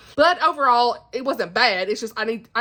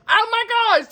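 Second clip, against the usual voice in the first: normal talking voice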